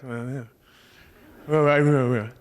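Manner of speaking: mumbled, unintelligible British grumble; low mock-British grunting, words lost in the mumble; grumpy old British guy. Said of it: "why British people talk like that".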